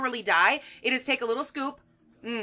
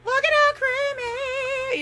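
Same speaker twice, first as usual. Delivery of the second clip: very high, slow gushing; high delighted singsong; 13 semitones higher, much slower than usual. Real, not acted. Showing viewers the creamy butter, gushing. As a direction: gush over it like a treasure.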